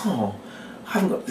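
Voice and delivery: soft, dismayed sigh rising in pitch; quiet acted disappointment; sincere helplessness. Shown as a demonstration. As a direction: sound genuinely stuck. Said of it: "real kind of sincerity about not being able".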